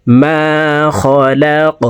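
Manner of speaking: মা held one beat, খলাক bounced at the end; one-alif stretch. Shown as a demonstration. Teacher demonstrating the vowel length. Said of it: "মা এখানে এক আলিফ টান".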